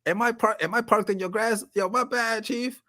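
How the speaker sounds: high, breezy mock apology; carefree delivery kid. Storyteller voicing the pizza kid apologizing to the golfers. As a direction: toss off a cheeky no-worries apology. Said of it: "The kid is like".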